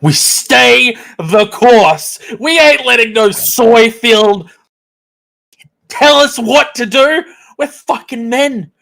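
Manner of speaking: slow, loud war cry; rally the troops, chanting; rallying shout. Acted. Rallying the chat against them.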